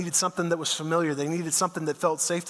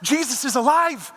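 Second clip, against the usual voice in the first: much higher, bursting with news; excited Mary; 7 semitones higher than usual. Retold or imitated. Mary bursting in on the hiding disciples, excited and high. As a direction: blurt it out like she ran all the way. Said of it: "She's all excited. She's out of breath".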